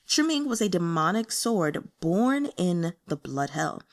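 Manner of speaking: ordinary delivery, nothing marked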